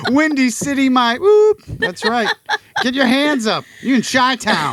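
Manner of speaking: high, loud playful holdup voice; excited mock robber. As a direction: squeal it like a jokey stickup.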